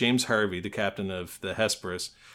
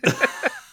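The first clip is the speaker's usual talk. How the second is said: high squeal of laughter; 4 dB louder than usual. Real, not acted. Hermione impression attempt.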